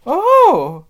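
high, loud startled "Oh!"; sudden cry. A narrator's reading. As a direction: cry out as if caught by surprise. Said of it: "said Susan suddenly".

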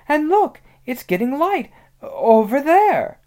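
high, loud, amazed calling out; excited discovery. Acted. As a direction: point it out eagerly, stretching the final words. Susan pointing out the light.

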